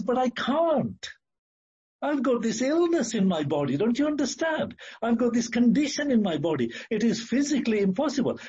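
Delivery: high-pitched, exasperated pleading; pleading protest. Acted. Sick body answers the heart and mind, pleading protest. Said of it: "And the body says".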